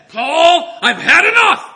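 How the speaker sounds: loud, high, furious outburst at Paul; furious shout. Acted. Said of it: "he spoke with force".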